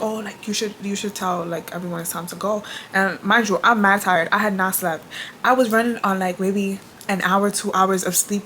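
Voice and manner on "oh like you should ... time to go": a hushed, hinted request from the wife; soft quote. Retold or imitated. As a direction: say it quietly, like a polite nudge. Quoting the wife softly.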